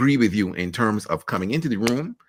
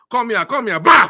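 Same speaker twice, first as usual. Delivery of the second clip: Jamaican gunman; 11 semitones higher, 12 dB louder than usual. Retold or imitated. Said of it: "That's like".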